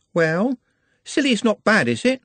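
put-on high, girlish voice, lovestruck; high girlish voice, dreamy; dreamy young girl. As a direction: sound like a smitten young shopgirl. Juliet's voice.

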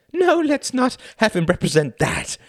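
high, nervous stage voice, laughing it off; nervous thin man. Acted. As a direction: back away from the gun with a jittery plea. Gun pointed at thin man.

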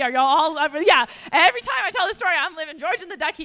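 bursting with high, loud delight; excited. Real, not acted. Preacher gushing to the room about VeggieTales, excited and loud. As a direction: gush about a favorite cartoon.

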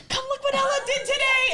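put-on shouted excited baby-parent voice; gushing new parents. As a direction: call out like a proud new mom. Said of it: "they're like".

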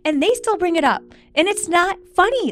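high, loud indignant outburst; angry. Real, not acted. Wife furious at husband over cruel joke, high and loud. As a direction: fume at him, voice rising.

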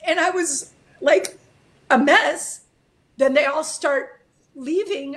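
high, halting, tearful voice; crying as you tell it; real tearfulness. Real, not acted. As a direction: push the words out through tears. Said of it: "I'm crying".

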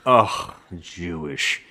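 slow, drawn-out disgusted groan; disgusted father. Retold or imitated. Drunk father reacting to the girlfriend, slow disgust. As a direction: sneer it out slowly. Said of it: "he's like".

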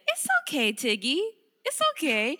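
put-on high, loud sing-song baby talk to a dog; soothing dog talk. Shown as a demonstration. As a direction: coo it brightly to cheer up the dog. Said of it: "she loves it when people say".

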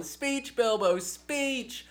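ordinary talk, nothing marked

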